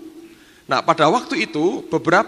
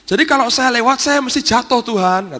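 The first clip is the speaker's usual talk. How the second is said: much higher and louder, anxious protest; a servant begging not to cross, pitched up; fearful plea; 10 semitones higher, 4 dB louder than usual. Retold or imitated. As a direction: insist she will surely fall.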